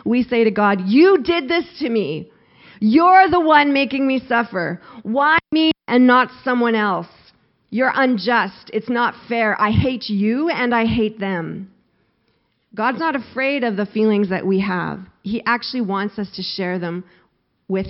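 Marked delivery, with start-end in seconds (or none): 0.9-11.7 loud, high outburst at God, slowing on the last charges; raised-voice protest, easing slightly toward the end; angry accusation. Acted. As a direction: hurl the blame in acted anger. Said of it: "We do the same thing".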